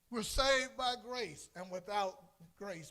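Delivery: loud, high, slow proclamation; preaching burst. Real, not acted. Preacher proclaiming grace to the congregation, loud and slow. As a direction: preach it out with full force.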